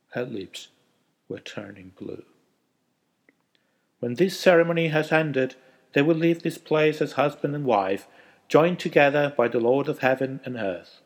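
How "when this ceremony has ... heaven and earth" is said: loud, high solemn officiant voice; wedding officiant. A narrator's reading. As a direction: proclaim it like a priest at the altar.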